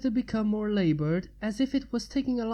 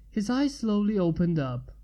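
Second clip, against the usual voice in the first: plain reading voice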